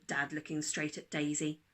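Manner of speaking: usual speaking voice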